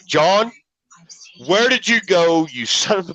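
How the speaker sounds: a hint of a gruff small-town cop calling out his buddy; Buford T. Justice. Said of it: "Sounded just like Buford T. Justice".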